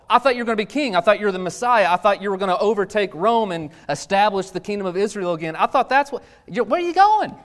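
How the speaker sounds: high, loud disbelieving questions; dismayed protest. Acted. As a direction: plead with him in confused dismay. Disciples protest to Jesus.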